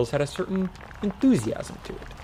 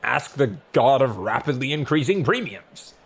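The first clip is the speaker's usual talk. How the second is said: demanding god; 4 dB louder than usual. A narrator's reading. God's demanding retort, loud.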